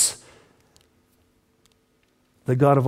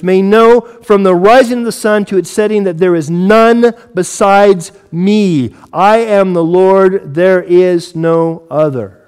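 forceful scripture proclamation; 3 semitones higher, 5 dB louder, slower than usual. Acted. Preacher reading God speaking through Isaiah, forceful proclamation. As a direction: proclaim it with weight, stretching the key words.

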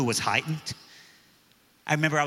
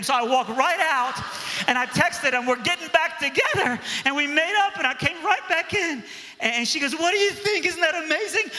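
put-on much higher, thrilled girlish voice; excited girl; 10 semitones higher than usual. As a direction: gush like an overjoyed teen girl. Girl excitedly reports getting back together.

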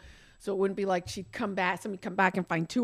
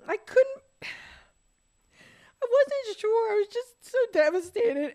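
high, very slow, wavering words through tears; real grief stretching the words; sobbing; 6 dB louder, slower than usual. Real, not acted. Recalling devastation at the vet.

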